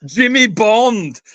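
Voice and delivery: slow, drawn-out mock Glasgow accent; playful Glasgow accent as James Bond. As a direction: ham up a Glasgow Bond, very slowly.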